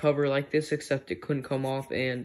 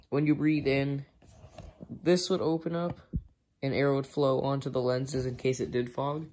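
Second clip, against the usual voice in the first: ordinary delivery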